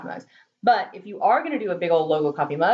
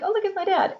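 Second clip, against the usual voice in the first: delighted recognition; 11 semitones higher, faster than usual. Retold or imitated. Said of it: "But I was like".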